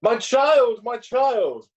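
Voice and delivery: high, loud cry of fond concern; emotional exclamation. Acted. Clergyman cries out to the young girl, emotional exclamation. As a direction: exclaim it high, drawing out each "child". Clergyman reacts to bereaved man's niece.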